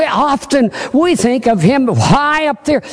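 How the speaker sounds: ordinary delivery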